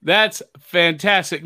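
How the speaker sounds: usual speaking voice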